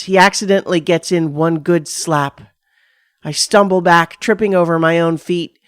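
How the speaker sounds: ordinary delivery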